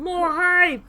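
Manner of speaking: high, loud hype shout; rally the chat; loud, high cheer to viewers; cheering call. Real, not acted.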